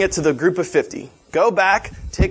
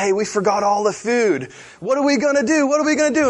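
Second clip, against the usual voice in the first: high-pitched, hammed-up fretting; mock panic; 9 semitones higher, faster than usual. John tells the doctor the food is forgotten, mock panic. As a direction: play a worried helper in a rising, higher voice.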